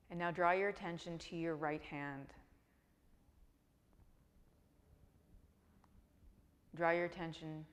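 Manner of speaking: calm, even meditation voice with long pauses; guided meditation. Real, not acted. Begins the guided Yoga Nidra.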